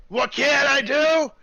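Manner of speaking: boastful dwarf topping his own question. Acted. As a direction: repeat it bigger, winding up to boast. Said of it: "is a dwarf".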